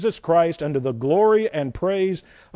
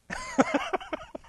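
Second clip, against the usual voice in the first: high amused laugh; 8 dB quieter than usual. Real, not acted. Laugh after joke.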